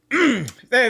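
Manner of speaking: ordinary talk, nothing marked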